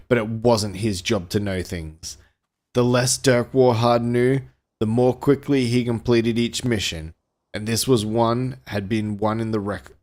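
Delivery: dramatic pulp-thriller read-aloud; deliberate storyteller delivery; story narration. A narrator's reading. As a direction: narrate an action novel with relish.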